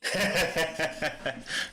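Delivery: real burst of laughter. Real, not acted. Streamer amused by a chat comment, laughing.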